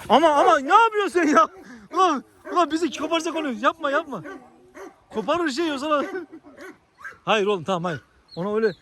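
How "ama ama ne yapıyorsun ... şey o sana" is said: much higher alarmed pleading. Real, not acted. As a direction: beg the dog to stop, panicking. Dog pulling hard, alarmed. (köpeğe telaşla yalvarma)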